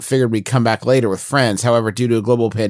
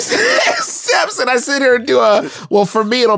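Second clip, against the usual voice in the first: laughing shout; 10 semitones higher than usual. Real, not acted. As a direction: shriek it while laughing.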